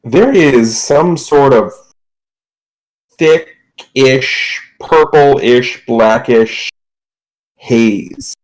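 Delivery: slow, drawn-out descriptive narration; game master scene setting. Acted. Game master reveals a strange haze to the player, slow scene setting. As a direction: describe it slowly, pausing between each detail.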